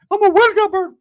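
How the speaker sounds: high, loud mock bird cry; squawky bird voice.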